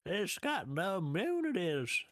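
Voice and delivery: high, slow old man impression. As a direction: stretch it out like a frail old man. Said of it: "he kinda talks like".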